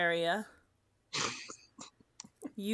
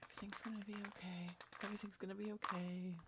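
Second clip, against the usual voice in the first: fast, much softer repeated murmur; soft whisper; 15 dB quieter, faster than usual. Acted. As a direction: mumble it quickly like a mantra. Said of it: "whispering to himself".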